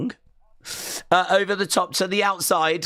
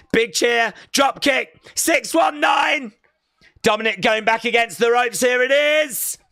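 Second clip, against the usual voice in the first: wrestling commentator; 5 semitones higher than usual. Real, not acted. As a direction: hype every move as it lands. Live call of the big finish.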